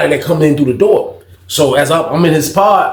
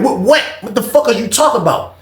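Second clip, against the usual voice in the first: incredulous; 8 semitones higher than usual. Retold or imitated. Incredulous reaction.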